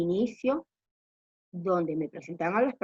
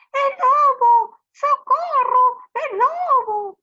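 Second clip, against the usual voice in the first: high, loud drawn-out cry for help; shouted alarm; 10 dB louder, slower than usual. Acted. Shepherd calls villagers for fun, shouting wolf. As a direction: holler it long and high across the fields. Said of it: "se puso a gritar".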